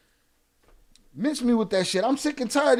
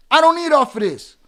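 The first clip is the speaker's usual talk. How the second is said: louder, harder repeat; louder angry retort; 8 semitones higher, 11 dB louder than usual. Real, not acted. Streamer repeats denial to chat, shouting. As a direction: shout it the second time.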